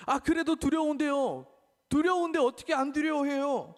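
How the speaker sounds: high, loud whiny objection; fearful protest. Acted. Believer answering God's command, high whiny protest. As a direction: plead like a scared child.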